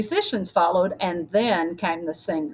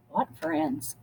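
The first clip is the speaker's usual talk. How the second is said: whispered; 4 semitones higher, 7 dB quieter than usual. Acted. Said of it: "Lupe whispered to Roberto".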